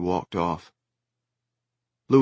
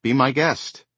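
loud irritated retort; snapped; 6 semitones higher, 5 dB louder than usual. Acted. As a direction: snap it back. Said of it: "Lewis snapped".

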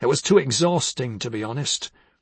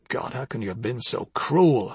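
ordinary talk

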